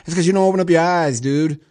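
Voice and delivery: answering the nominee with mock outrage; exasperated retort. Real, not acted. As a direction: snap back at him in disbelief. Host retorts at quoted Carson.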